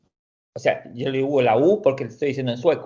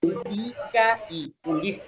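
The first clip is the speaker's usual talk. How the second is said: ulica and ulica, laughed out on a rising shout; laughing contrast; 4 semitones higher, 4 dB quieter than usual. Shown as a demonstration. Teacher laughs while contrasting both words for the class. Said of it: "teacher contrasts both Swedish words".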